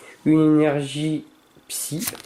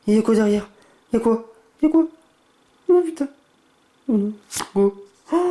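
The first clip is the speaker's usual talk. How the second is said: excited high yelps; 9 semitones higher, much faster than usual. Real, not acted. Collector checking the back of the rare card, high excited yelps. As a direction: yelp in excited disbelief.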